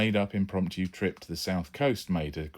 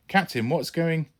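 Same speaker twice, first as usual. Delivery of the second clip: alarmed call; 10 semitones higher, 6 dB louder than usual. Acted. Tom bursts in with rifle.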